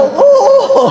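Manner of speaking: put-on high squeaky old-lady answer; comic old-lady yes; hard-of-hearing old woman. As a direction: squeak it like a deaf granny. Playing a deaf old woman.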